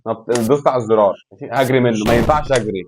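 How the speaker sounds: usual speaking voice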